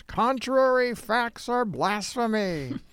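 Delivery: high, drawn-out pretend yell; mock yell. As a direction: imitate a girl yelling it, slow and high without real volume. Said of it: "and then she yells".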